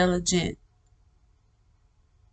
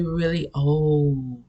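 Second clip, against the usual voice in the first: put-on drawn-out, slow 'old'; slow, imitating an ancient voice; really slow; 3 semitones lower, slower than usual. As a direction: stretch the words out slowly. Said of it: "and, like, really slow".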